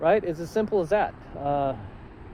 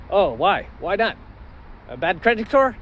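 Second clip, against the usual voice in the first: higher, louder incredulous salesman; mock surprised salesman; 6 semitones higher, 4 dB louder than usual. Salesman surprised at a cash buyer, higher and louder. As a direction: play the salesman caught off guard.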